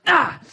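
a high exasperated "Ah"; frustrated coach groan. Retold or imitated. Coach groaning at his team, high exasperated cry. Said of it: "it's like".

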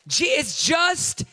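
shouted, high preacher's cry; preaching at full force. Acted. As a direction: preach it loud and rising.

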